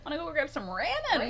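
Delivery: short "a" as in ram on ramen; mock mispronunciation. Imitating friend's food invitation, mock mispronounced ramen. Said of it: "Ramen, the whitest person".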